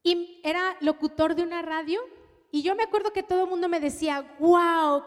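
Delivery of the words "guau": excited exclamation. Retold or imitated. Friends praising the suitor.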